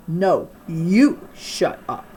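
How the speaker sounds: slow, loud, hard comeback; angry retort. A narrator's reading. Girl shouting back at Jason in the auditorium. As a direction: throw the words back at him.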